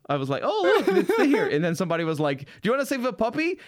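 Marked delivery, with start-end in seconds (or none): 0.4-1.5 high-pitched squeal of delighted recognition, half laughing; light up at a familiar sight, pitch leaping; excited recognition. Retold or imitated. Visitor retelling spotting Times Square, excited and high. 2.4-3.6 high, quick imitation New York accent. Visitor imitating a stranger who approached them, New York accent. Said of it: "in a very New Yorkish accent".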